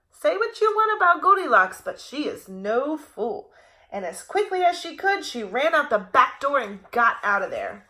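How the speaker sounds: ordinary delivery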